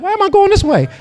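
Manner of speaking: high, startled question; confused surprise. Pretend. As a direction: sound suddenly lost and puzzled. Confused sheep pushed by God.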